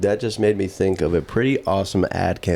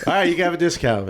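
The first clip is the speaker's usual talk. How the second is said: ordinary talk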